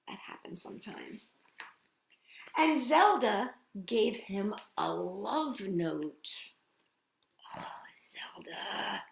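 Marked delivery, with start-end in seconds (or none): 0.1-1.3 soft comforting whisper to little listeners; whispered aside. Acted. 7.6-9.0 slow teasing whisper; whispered sigh of a name. Acted. Whispered knowing aside.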